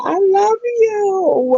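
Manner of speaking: very high, slow and drawn out; gushing love. Real, not acted. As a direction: stretch it out like you adore them. Gushing to viewers joining.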